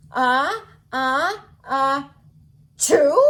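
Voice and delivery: unsure mock sneeze ending in a question; trying an elbow sneeze. Shown as a demonstration. Copying Elmo's sneeze lesson, tentative mock sneeze. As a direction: try a pretend sneeze, checking. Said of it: "just like this".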